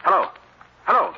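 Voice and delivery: high and loud hello into a dead line; urgent hello. Acted. Cranston shouting into a dead phone line, urgent. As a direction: call out to someone who just hung up.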